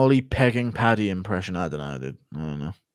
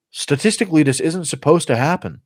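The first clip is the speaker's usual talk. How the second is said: lightly put-on, higher voice; Anthony Smith impression; 6 semitones higher than usual. Anthony Smith losing on the ground, mock puzzled protest. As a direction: protest the result, pitched up a little. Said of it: "Anthony Smith and Presh".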